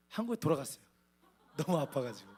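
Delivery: ordinary delivery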